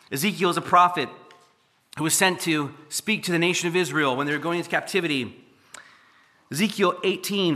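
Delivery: fuller, rising sermon voice; preaching God's call. Acted. Preacher voicing God calling everyone, a building sermon. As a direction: proclaim it to the whole room.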